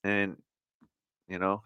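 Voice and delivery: ordinary talk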